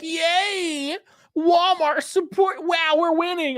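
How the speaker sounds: high, mocking cheer, slow on support; sarcastic high-pitched celebration; mock cheering fan. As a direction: ham up a giddy supporter. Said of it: "people on the left were like".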